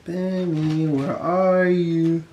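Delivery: ordinary delivery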